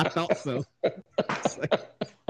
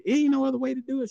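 mock pleading; 11 semitones higher, faster than usual. Pleading with nurse in retold exam.

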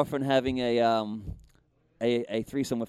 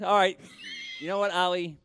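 usual speaking voice

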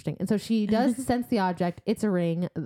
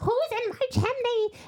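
very high, slow creature call; Weaver voice; slower than usual. Retold or imitated. Blind Weaver looking up the chimney, high creature voice. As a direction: draw it out in a high monster voice. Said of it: "looking up and she's like".

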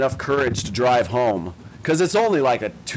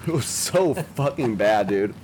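ordinary delivery

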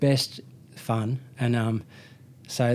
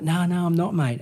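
higher-pitched mock denial; retold protest; 5 semitones higher than usual. Accused young man denies faking to the guard, retold protest. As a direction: replay your protest with the pitch jumping up.

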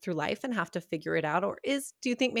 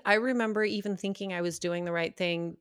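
normal talking voice

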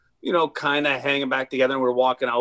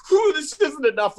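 high, louder imitation of rage; mock mad; 13 semitones higher, 4 dB louder than usual. Imagining the man smashing the van, mock fury. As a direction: act out someone too mad to stop. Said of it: "You know you're mad when you're like".

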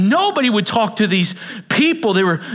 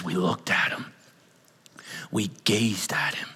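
awed whisper; 12 semitones lower, 8 dB quieter than usual. Real, not acted. Whispered in awe.

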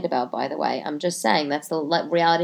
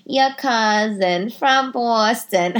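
slow, drawn-out Boston ad voice; mock Boston commercial accent; 2 semitones higher, much slower than usual. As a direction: ham up the Boston beer ad accent. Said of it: "the voice is so nasal".

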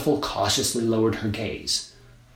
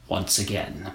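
ordinary delivery, nothing marked

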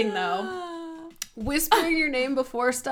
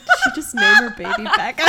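high, fast, squeaky baffled voice; shocked bystander; 8 dB louder, faster than usual. Pretend. Mocking a confused delivery nurse in high squeak. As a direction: play a stunned onlooker.

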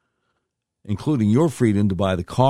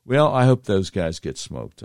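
normal talking voice

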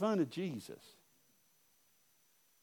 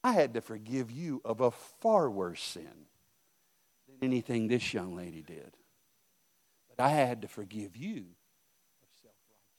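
voice of the Lord; 3 semitones lower, 5 dB quieter than usual. Retold or imitated. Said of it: "the Lord spoke to her and said".

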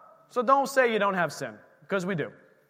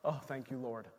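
soft grateful prayer; 9 semitones lower, 14 dB quieter than usual. Real, not acted. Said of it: "quiet prayerful aside".

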